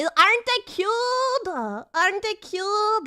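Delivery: high, drawn-out cooing; cutesy gushing. Real, not acted. Streamer shows off own nails to chat, cutesy. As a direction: coo slowly in a high, cute voice.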